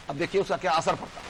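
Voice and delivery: ordinary talk, nothing marked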